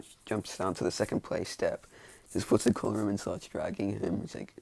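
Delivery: ordinary talk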